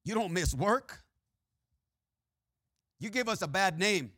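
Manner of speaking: normal talking voice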